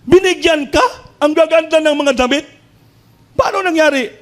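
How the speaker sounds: high, astonished lady of the house; amazed employer. Acted.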